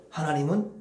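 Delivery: gentle quiet blessing; tender hushed blessing; soft blessing. Shown as a demonstration. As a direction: murmur it lovingly. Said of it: "뭐라고 해야 돼".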